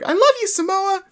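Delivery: high-pitched imitation of a screaming fan; kid fan squeal. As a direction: squeak it like a star-struck kid.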